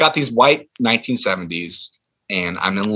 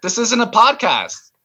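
high-pitched, exasperated protest; fed-up complaint; 11 semitones higher than usual. Acted. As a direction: plead with them to focus. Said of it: "I'm getting really angry".

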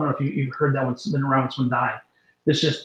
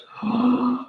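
a sharp high gasp for air; 8 semitones higher than usual. Retold or imitated. Healed man's first gasp for breath. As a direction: gasp like a man who can suddenly breathe.

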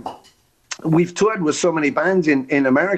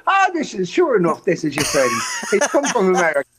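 Irish taxi driver; 3 semitones higher, faster than usual. Retold or imitated. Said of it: "he'll take you to someone's house and go".

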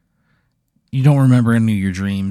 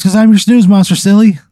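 much higher, louder, friendly creature voice; cheery snooze monster; 11 semitones higher, 8 dB louder than usual. Acted. Snooze monster greeting Pat with a high, chummy voice. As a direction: greet him like an old buddy, high and bright.

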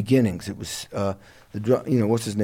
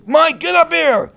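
much higher, louder slow call across the room; 7 dB louder than usual. Retold or imitated. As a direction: summon him up to the stage. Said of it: "John goes".

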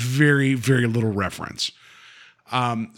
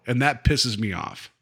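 usual speaking voice

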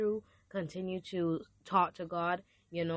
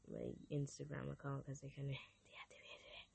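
half-whispered, much softer aside with a laugh; 4 semitones lower, 17 dB quieter than usual. Real, not acted. Host muttering about her Instagram being deactivated, whispered.

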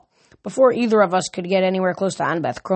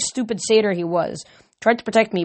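usual speaking voice

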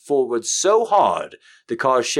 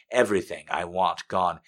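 usual speaking voice

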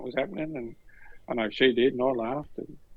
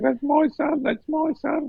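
very high, squealed imitation of an overcome mum; acted high-pitched wail of pride; tearful proud mum; 3 dB louder, slower than usual. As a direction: cry it out high, bursting with pride. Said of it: "she was bawling her eyes out".

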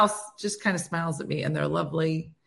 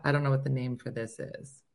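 normal talking voice

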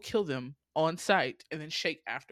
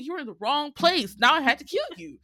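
mock scolding; 7 semitones higher, 8 dB louder than usual. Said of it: "But I'm like".

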